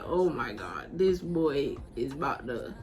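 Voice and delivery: a hushed, worried aside; under the breath. Retold or imitated. Narrator dreading what Rinaldi might do, under the breath.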